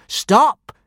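sharp high yelled command; shout. A narrator's reading. Prefect yells at the gronkel attacking the kidnapper, loud shout. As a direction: stop the dragon with one loud cry. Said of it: "shouted the thin prefect".

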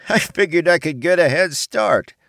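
laughing nervously through the words; an uneasy laugh while she talks; nervous chuckle. A narrator's reading. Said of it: "she chuckled nervously".

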